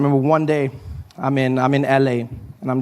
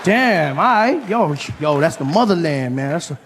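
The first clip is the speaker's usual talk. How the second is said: amazed American comedian; 3 semitones higher than usual. Acted. American comedian meeting a South African backstage, amazed dude voice. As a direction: marvel at meeting someone from Africa.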